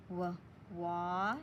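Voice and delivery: wa- stretched from w to wa; sounding out. Shown as a demonstration. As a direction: sound out the start of water slowly. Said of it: "A W A that makes that aw sound".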